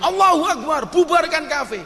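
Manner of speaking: high-pitched mock protest yell; imitated street shout. Protesters heading to a cafe, mock shouting. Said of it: "di jalan teriak-teriak".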